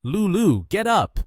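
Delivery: high, loud summons; calling out. A narrator's reading. Calling a friend buried in the sand, loud call. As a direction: call sharply up in pitch to rouse a friend. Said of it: "called the girl".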